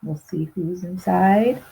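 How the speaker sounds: ordinary delivery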